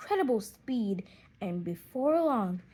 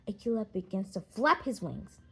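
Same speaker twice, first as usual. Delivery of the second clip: usual speaking voice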